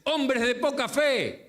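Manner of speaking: high, slow rebuke; mock scolding. Imagined cranky Jesus rebuking his disciples. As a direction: scold them slowly. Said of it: "Y encima le dice".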